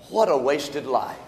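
low, soft reflection; subdued lament. Real, not acted. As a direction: say it low and soberly, under your breath. First take of a repeated lament.

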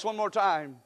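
ordinary delivery

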